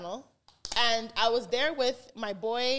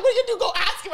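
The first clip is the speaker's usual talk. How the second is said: mock shout; 10 semitones higher, 8 dB louder, much faster than usual. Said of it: "we're like".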